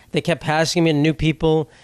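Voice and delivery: ordinary delivery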